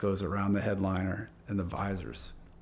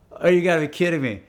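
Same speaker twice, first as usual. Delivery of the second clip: loud, higher retold disbelief; disbelieving; 7 semitones higher, 8 dB louder, faster than usual. Owner told the paint booth burned down, disbelieving. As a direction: exclaim it in exasperated disbelief.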